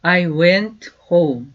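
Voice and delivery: slow, clear teacher's model, each word apart; slow demo pronunciation; slow model sentence. Shown as a demonstration. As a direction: say it slowly for students to repeat. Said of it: "다시요".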